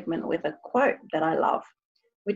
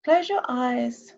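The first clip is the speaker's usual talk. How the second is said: slow, calm meditation voice; guided meditation; 5 semitones higher, slower than usual. Acted. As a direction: guide them gently, slowly. Leads a meditation.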